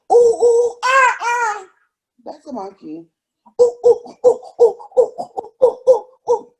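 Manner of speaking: high, bouncy "ooh ooh ah ah" monkey calls; monkey noises. Pretend. Mom acting silly monkey for her toddler, high hooting calls. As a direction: hoot like a silly monkey, breaking into a laugh.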